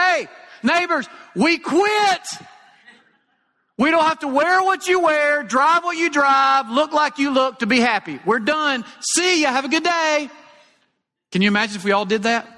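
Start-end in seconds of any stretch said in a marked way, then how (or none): 0.0-10.3 high, loud, drawn-out holler; calling out to neighbors. Pretend. Preacher pretends to address the whole neighborhood, hollering. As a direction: shout it high across the street to the neighbors. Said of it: "put our hands over our mouths and say".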